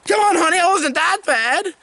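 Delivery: high-pitched, whiny comic plea; hammed-up boyfriend begging her back; mock pleading. Jilted man calls to fleeing girlfriend, mock pleading.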